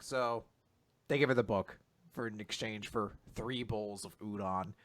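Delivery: ordinary talk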